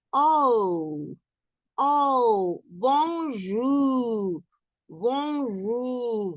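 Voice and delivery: oh and bonjour with lips held round; rounded French oh. Shown as a demonstration. Teacher modelling the rounded vowel for students. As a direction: keep the mouth round through bonjour. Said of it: "tienen que hacerlo como".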